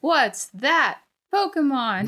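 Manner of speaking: slow high announcer question; drawn-out cartoon quiz voice; Pokemon narrator voice. Mimics the Pokemon show question.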